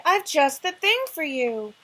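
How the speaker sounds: loud excited cry; cried out. Acted. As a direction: call it out with delight. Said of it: "Alice cried".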